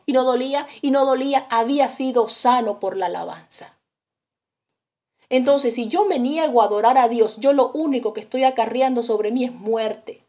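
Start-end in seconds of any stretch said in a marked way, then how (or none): none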